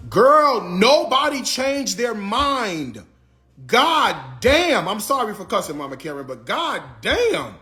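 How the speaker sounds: fed up, tear into them; real exasperation at viewers who came in late; exasperated rant. Real, not acted.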